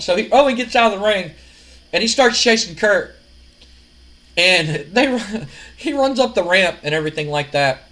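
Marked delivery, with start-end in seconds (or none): none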